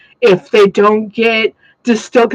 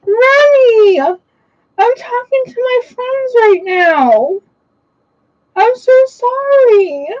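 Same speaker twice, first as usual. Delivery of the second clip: much higher, slow cooing pet talk; 12 semitones higher, much slower than usual. Real, not acted. Apologising to her upset dog Remy mid-stream, high slow baby talk. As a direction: soothe the sulking puppy, drawn out and sweet.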